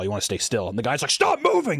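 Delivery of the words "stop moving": high-pitched mock yell; retold yelling. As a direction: imitate someone yelling a panicky order. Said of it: "he's yelling in my ear".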